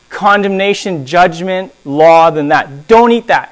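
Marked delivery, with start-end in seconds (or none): 2.9-3.5 a firm, louder command with DON'T punched; stern command. Shown as a demonstration. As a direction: lay down the law on "Don't". God's command said twice.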